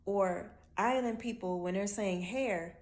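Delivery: usual speaking voice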